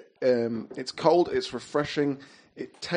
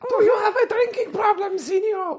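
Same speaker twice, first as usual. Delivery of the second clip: squeaky puppet voice. Acted. Replying as the hand puppet.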